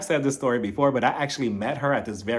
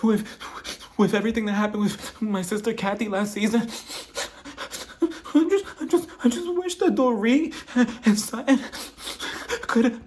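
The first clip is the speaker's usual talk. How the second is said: higher, halting whiny voice; Kyle's weepy stumbling complaint, pitched up; mock Kyle whine; 8 semitones higher than usual. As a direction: stammer it out in a high pleading whine, mocking her. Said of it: "She started off like".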